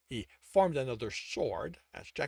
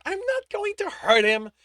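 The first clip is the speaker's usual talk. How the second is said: dismissive; 10 dB louder than usual. A narrator's reading. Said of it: "Tibbs replied dismissively".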